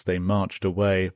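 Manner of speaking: plain reading voice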